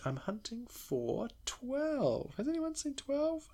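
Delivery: high, slow, playful lost voice; high mock searching voice. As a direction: drag it out high like you're lost. Mock lost searching his notes.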